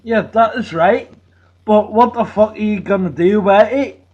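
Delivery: ordinary talk, nothing marked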